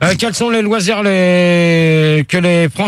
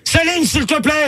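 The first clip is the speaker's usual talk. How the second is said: high-pitched exasperated cry; real yelling; 8 semitones higher than usual. Real, not acted. Irritated host calls Céline to order, yelling high. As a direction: call out high to rein someone in. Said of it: "si tu pouvais arrêter de crier".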